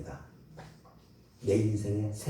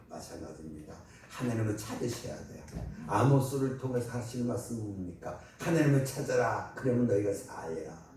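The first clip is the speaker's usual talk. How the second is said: normal talking voice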